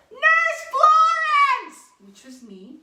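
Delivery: very high, loud, drawn-out shout; urgent call to someone far away; yelling across the square. Retold or imitated. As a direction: bellow it across a crowded plaza. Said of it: "He yells across Town Square".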